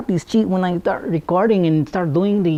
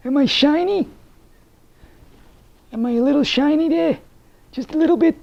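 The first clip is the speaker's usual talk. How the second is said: high, fussy talk-show lady voice, higher throughout; verklempt hostess; 7 semitones higher than usual. Acted. Playing a flustered hostess asking the viewers if she looks shiny, in a high fussy voice.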